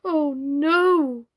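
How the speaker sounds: high, loud anguished cry. A narrator's reading. Doll Mister Plantagenet crying out against ordinary chairs, high and loud. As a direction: plead in alarm. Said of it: "cried Mister Plantagenet".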